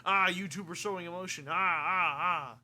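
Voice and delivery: mock alarmed yelling; mock panic. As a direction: cry out in pretend horror. Mocks viewers alarmed by his emotion.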